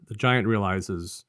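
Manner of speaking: ordinary talk, nothing marked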